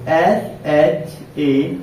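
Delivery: each letter of S H A M E spaced out slowly; teacher dictating letters of a word one by one; slow letter-by-letter spelling. Shown as a demonstration. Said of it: "देखिए ऐसे".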